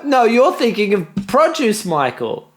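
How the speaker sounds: high, slow, loud protest; indignant shout. Real, not acted. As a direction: shout it in disbelief. Protesting loudly at being fired.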